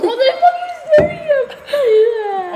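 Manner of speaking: high, slow shriek with a cry in it; yelling at the staring cat, high and drawn out; startled yell. Retold or imitated. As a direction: shriek at the cat as if scared stiff. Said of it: "I'm like".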